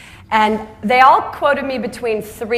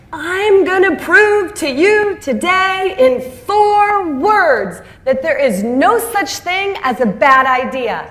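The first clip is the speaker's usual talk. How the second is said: booming, slow, much higher stage voice; larger-than-life speaker; 8 semitones higher, 4 dB louder, slower than usual. Retold or imitated. Convention speaker proving it to the crowd, booming and slow. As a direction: announce it like a showman promising a miracle. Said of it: "this larger-than-life speaker got up on stage".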